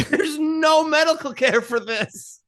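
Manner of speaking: high-pitched, drawn-out mock lament; mock wailing mother. Mother with no medical care for baby, mock wailing. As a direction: wail it slowly in fake despair.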